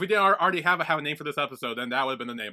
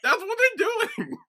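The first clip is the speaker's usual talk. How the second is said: high, loud, drawn-out protest; stretched high wail of amusement; laughing insistence; 9 dB louder, slower than usual. Real, not acted. Co-host defends crude joke, laughing.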